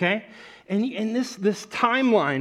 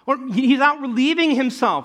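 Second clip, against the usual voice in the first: higher, louder sing-song taunting; mocking taunt; 8 semitones higher, 5 dB louder than usual. Elijah mocking the prophets of Baal, loud taunt. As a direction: jeer at rivals as if their god is napping. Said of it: "He's mocking them".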